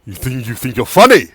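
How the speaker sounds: loud, scornful taunt; mocking anger. Tiefling scornfully mocking Garros. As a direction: sneer at him.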